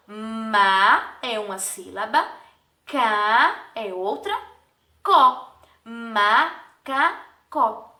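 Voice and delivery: "Ma", "ca", "có" each drawn out on its own; teacher sounding out each syllable of "Macacó". Shown as a demonstration. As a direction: slowly break "Macacó" into separate syllables. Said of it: "pronunciada em uma única emissão de voz".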